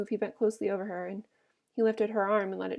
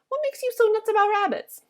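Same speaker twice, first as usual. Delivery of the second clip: high-pitched, louder, incredulous question; exasperated woman; 12 semitones higher, 6 dB louder than usual. A narrator's reading. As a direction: put on a high female character voice. Curly's wife exasperated with Lennie.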